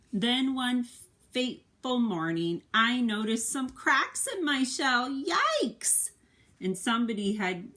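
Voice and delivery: expressive picture-book narration; reading to children. A narrator's reading. Parent reading the egg's story to a child, expressive narration. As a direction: tell it to a little one, with feeling.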